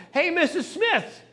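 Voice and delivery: husband calling to his wife in the kitchen. Retold or imitated. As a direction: call her from across the house, half acted. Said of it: "He yells to his wife".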